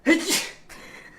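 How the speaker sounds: sudden sharp sneeze; real sneeze bursting out; loud sneeze. Real, not acted. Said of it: "He says, "Achoo!".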